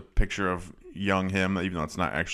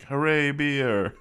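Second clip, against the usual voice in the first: drawn-out, high Jamaican beer-ad cheer; Jamaican accent; 7 semitones higher, much slower than usual. Retold or imitated. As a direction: imitate a slow Jamaican cheer. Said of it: "like, Jamaican accent".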